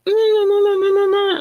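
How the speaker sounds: high squeaky mock argument babble; mock Portuguese bickering. Retelling a back-and-forth with her Brazilian man, high mock babble. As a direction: squeak out nonsense like a partner bickering. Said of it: "in Portuguese".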